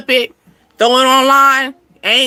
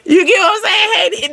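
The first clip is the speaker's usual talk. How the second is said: can't stop laughing while asking; laughing at her own cheeky advice; 8 semitones higher than usual. Real, not acted. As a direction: question squeezed out through a laugh, higher.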